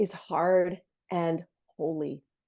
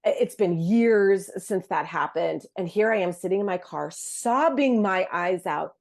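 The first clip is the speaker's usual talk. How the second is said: normal talking voice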